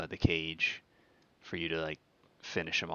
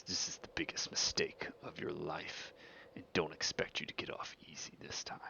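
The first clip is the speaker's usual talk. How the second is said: soft, breathy menace; quietly ominous, half-whispered warning; hushed threat; 7 dB quieter than usual. Acted. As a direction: warn them under your breath. Character threatens challenger before storming off.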